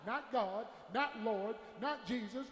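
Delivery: ordinary delivery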